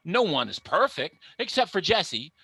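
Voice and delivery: ordinary talk, nothing marked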